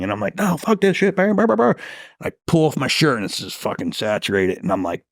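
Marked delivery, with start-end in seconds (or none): 0.4-1.7 high-pitched, agitated mock rant; retold yelling. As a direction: re-enact refusing to calm down. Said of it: "stop yelling".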